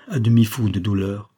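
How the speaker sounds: ordinary delivery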